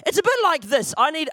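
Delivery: ordinary delivery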